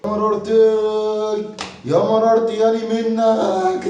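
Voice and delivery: slow drawn-out wail of despair; mock lament. Father despairing over son, slow mock wail. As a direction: wail it like a put-upon parent.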